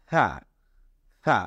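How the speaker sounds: "সা" as a light th, air slipping between tongue and teeth; light airy "th". Shown as a demonstration. Teacher tests air on the hand, "সা" as light th. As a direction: let a little air out on "সা", tongue tip at upper teeth. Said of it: "হালকা একটু বাতাস বের হয়ে যাবে".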